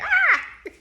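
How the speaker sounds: high shrieking startled yell; startled scream. Retold or imitated. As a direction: shriek as if surprised. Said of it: "You're like".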